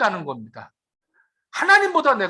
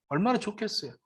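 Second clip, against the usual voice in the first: usual speaking voice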